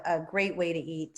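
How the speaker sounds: ordinary talk, nothing marked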